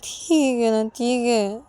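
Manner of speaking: high, whiny giving in; sulky Ananya, resigned. Acted. Ananya gives up and agrees to sleep, sulky whining. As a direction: concede with a plaintive huff.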